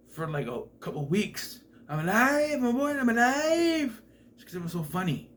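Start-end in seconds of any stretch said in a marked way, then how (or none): 1.9-4.0 high, loud, slow hammed-up cry of joy; mock a wooden boy thrilled to be alive; ecstatic puppet. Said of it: "I had that as my ringtone".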